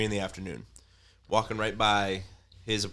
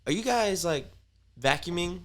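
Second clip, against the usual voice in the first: higher hesitant questioning; prying landlady; 9 semitones higher than usual. Retold or imitated. Apartment office asking about vacuuming, higher hesitant question. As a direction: ask it awkwardly. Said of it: "She's like".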